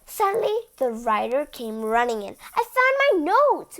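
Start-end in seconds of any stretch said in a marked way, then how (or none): 2.5-3.8 high, loud triumphant cry; excited announcement. Acted. The writer running in, calling out excitedly. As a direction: burst in with news.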